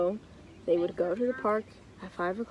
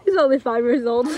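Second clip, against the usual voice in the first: loud, quick, laughing through the words; fast laughing outburst; real laughing protest; 3 semitones higher, 8 dB louder, faster than usual. Real, not acted. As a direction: crack up while pointing out the absurdity. Cracks up at own story.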